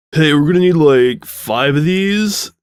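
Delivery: loud, slow surfer call across the room; shouting. Acted. Quarterback shouting to Sudsy from the back, slow loud call. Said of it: "he shouted".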